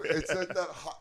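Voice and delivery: ordinary delivery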